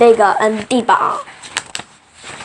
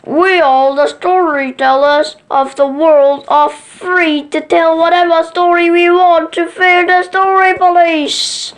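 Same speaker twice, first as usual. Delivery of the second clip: higher, lightly voiced character making a triumphant speech; a hint of a jubilant moose voice; Doctor Moose proclaiming; 7 semitones higher, 3 dB louder than usual. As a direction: proclaim it like a freed storyteller, pitched up. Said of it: "says Doctor Moose".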